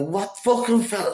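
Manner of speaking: high, incredulous "what fucking fella"; baffled outburst. Retold or imitated. Patient baffled by the surgeon's joke, incredulous high outburst. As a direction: fire it back, totally lost. Baffled retort to doctor's riddle.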